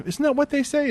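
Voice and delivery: high-pitched, doubtful imitation; unsure question. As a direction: quote her as if not really sure. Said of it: "As if she's not really sure".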